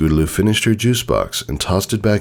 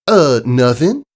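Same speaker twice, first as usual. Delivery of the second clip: high, louder country filly; Applebloom's voice; 9 semitones higher, 5 dB louder, slower than usual. Acted. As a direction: speak up high like a caring young pony.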